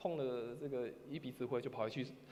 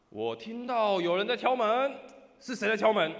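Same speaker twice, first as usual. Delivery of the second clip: loud and slow, higher; 7 semitones higher, 13 dB louder than usual. Retold or imitated. Person inside loudly asking who knocks. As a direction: boom it out from inside the church. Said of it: "换里面人大声说".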